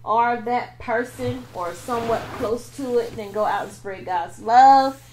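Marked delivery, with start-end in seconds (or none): 3.2-5.0 slow, loud sermon-style pitch; preachy plug. Pretend. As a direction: preach it to the viewers.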